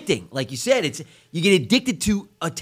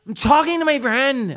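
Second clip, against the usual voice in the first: put-on higher, annoyed teen snapping what; snippy teen daughter; 7 semitones higher, 5 dB louder, much slower than usual. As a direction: brush dad off, you're busy with a friend. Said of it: "I go".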